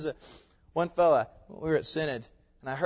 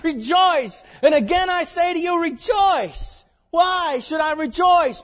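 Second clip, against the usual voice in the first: loud, high-pitched sermon delivery; full-force preaching; 13 semitones higher, 11 dB louder, slower than usual. Real, not acted. Pastor proclaiming coming joy to his congregation, full-force preaching. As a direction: preach it out to the back pews.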